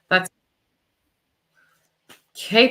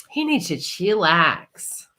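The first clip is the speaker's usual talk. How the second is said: slow, drawn-out; 3 dB louder, slower than usual. Real, not acted. Tarot reader telling a client her twin needs calm, slowly drawn out. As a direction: stretch out "He needs to chillax" slowly, easygoing. Reader channelling the partner's anxiety.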